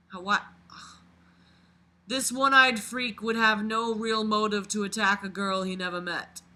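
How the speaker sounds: normal talking voice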